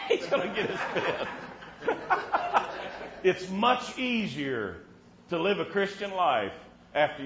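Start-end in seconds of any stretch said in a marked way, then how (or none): 1.3-3.2 airy laughter, no voice; breathy laugh. Real, not acted. Laughing at listener.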